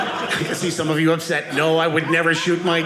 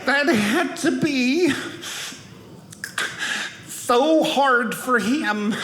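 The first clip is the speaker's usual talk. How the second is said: slow teary mock sob, higher; 5 semitones higher, much slower than usual. Imitating the Bible study woman crying over the story. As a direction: ham up a weepy lament. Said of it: "She's like".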